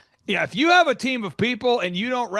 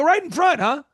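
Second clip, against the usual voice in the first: mock surprise; 9 semitones higher, 4 dB louder than usual. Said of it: "I go".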